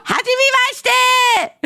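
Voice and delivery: high squeal of a greeting; squeaky happy greeting. Acted. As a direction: squeal it cheerfully. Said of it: "she's like".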